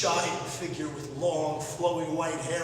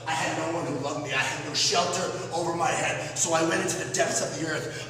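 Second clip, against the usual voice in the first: ordinary talk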